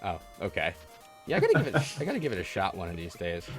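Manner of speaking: ordinary talk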